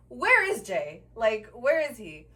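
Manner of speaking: loud, emphatic retort; retold yelling back. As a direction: throw the question back loudly, as retold. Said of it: "he's still screaming at me".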